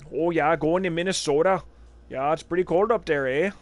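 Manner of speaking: a hint of a Fargo-style Minnesota accent; mild mock Minnesota accent ending on eh. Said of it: "That's kinda more Canadian".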